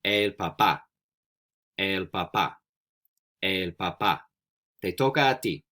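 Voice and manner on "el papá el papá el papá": "papá" stressed on the final syllable, pa-PA; model pronunciation. Shown as a demonstration. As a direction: model "El papá" for learners, accent on the end. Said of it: "I'm gonna say it three times".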